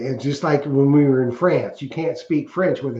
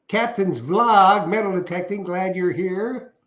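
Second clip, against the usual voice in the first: announcer intro; 4 semitones higher than usual. Acted. Channel sign-on catchphrase.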